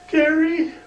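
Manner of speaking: very high, thin pleading voice; Carl's high voice. Retold or imitated. Impersonating Carl asking Kerry for advice, very high. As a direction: squeak it up like a meek young guy. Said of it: "he almost talked like this".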